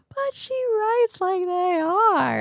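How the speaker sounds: high, loud, slow tearful protest; imitating blubbering. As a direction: protest through mock sobs. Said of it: "I am just a blubbering mess".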